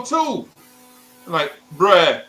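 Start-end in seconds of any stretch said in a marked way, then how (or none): 0.0-0.5 very high-pitched, punchy one-word retort; emphatic correction. Retold or imitated. Man counters with the amount given. 1.8-2.2 loud, one-word objection; exasperated protest. Retold or imitated. Boy protests the payment.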